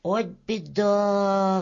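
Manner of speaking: slow, drawn-out sighed lament; sighing. Acted. Tow-bearded worker pities the starving people, sighing. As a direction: let the words out like a long sigh. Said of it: "вздохнул мужичок".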